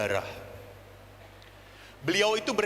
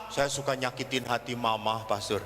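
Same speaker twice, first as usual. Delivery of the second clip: very low, subdued confession; acted downcast child's reply; contrite girl; 10 semitones lower than usual. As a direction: confess meekly, drop well down. Girl confessing she hurt her mother.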